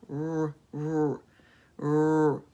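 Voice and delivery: long rolled-lip R; growled R sound. Shown as a demonstration. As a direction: poke your lips out and hold the R. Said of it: "just make the beginning sound".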